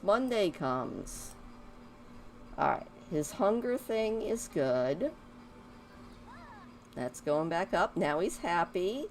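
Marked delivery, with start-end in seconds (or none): none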